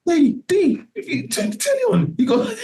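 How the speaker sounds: very high squeaky voice for his bandmate; high-pitched mock voice of a friend calling about the news; imitating Jay. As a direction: pitch way up, a cartoonish take on Jay. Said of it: "Jay going like".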